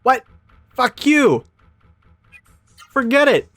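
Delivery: high furious shout at the screen; angry yelling. Real, not acted. Streamer yelling at a stingy game shopkeeper, furious shout. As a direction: blow up at the shopkeeper.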